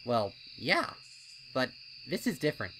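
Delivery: loud, fast, doubting colt voice; a wary colt talking his friend out of it; skeptical colt. A narrator's reading. Snips questioning Pipsqueak's wish to enter, loud and fast.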